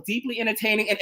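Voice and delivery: ordinary talk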